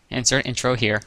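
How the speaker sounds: usual speaking voice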